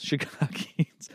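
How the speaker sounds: breathy laugh through the word Chicagians, slow; laughing whisper. Real, not acted. Laughing at his own word Chicagians, whispered slowly.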